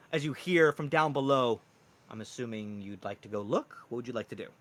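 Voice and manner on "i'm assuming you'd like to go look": usual speaking voice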